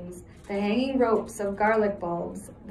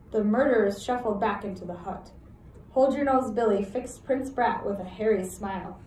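ordinary delivery, nothing marked

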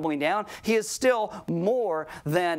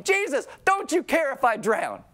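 higher, strained mock panic; panicked plea; 8 semitones higher than usual. Preacher voicing an anxious believer to Jesus, mock panic. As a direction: cry out like you're sinking.

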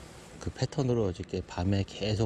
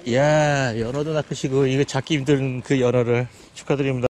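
excited praise; 3 semitones higher, 6 dB louder than usual. Real, not acted. Congratulating the salmon catch.

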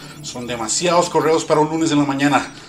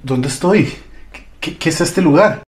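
confused; 4 semitones higher, 7 dB louder than usual. Acted. Confused arrival after crash.

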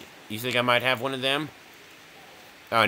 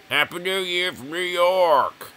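high, drawn-out mock New York accent; attempted New York accent; 7 semitones higher, slower than usual. Shown as a demonstration. Streamer greeting chat for New Year, attempting a New York accent. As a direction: belt out a badly faked New York accent. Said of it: "I can't do a New York accent".

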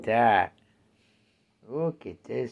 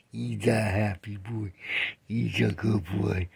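normal talking voice

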